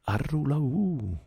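very high, very slow drawn-out howl; keen like a grieving bear; bear's wail. Acted. Baloo wails over losing Mowgli, slow high howl.

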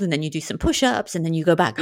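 ordinary delivery

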